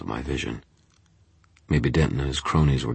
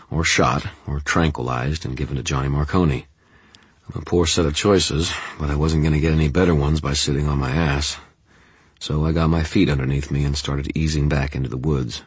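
ordinary delivery, nothing marked

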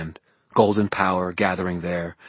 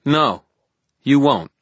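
firm defiance; 7 semitones higher, 7 dB louder than usual. A narrator's reading. Hero defies villain.

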